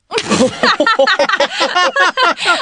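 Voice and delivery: high laugh at his own punchline. Real, not acted. [name] laughing at his own punchline, high laugh. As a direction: crack up at the joke.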